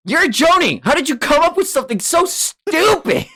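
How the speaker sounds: high, loud incredulous yell; exasperated outburst. Acted. Hothead berates a Jonin, exasperated high yell. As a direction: berate him in disbelief, voice way up. Acted quarrel in story.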